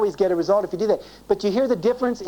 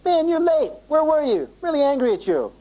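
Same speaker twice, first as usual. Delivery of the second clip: angry response; 9 semitones higher than usual. Shown as a demonstration. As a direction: snap at her for being late. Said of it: "My first response is".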